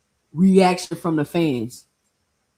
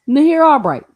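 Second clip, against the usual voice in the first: a louder incredulous callout of the name; loud mocking reveal; 6 semitones higher, 9 dB louder than usual. Real, not acted. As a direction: punch out the name louder, in disbelief. Reveals the surprise opponent.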